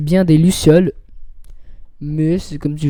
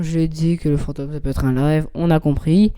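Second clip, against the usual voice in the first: ordinary talk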